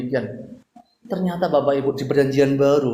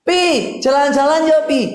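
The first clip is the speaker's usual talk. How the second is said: put-on much higher, louder wheedling child voice; whiny child; 10 semitones higher, 8 dB louder than usual. Child pleading with Papa for money, high wheedle. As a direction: beg Papa like a spoiled kid. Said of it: "Itu anak".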